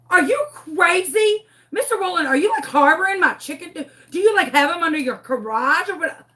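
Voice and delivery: high-pitched, worked-up questioning; acted indignant accusation. Frantic chicken owner accusing Mister Rowland, indignant. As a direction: grill the neighbor in mock outrage.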